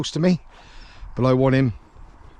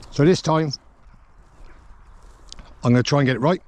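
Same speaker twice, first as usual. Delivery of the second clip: ordinary delivery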